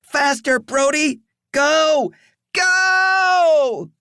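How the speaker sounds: high desperate screaming. Acted. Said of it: "screamed through the rags".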